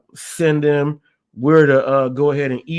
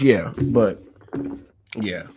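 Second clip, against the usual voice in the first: normal talking voice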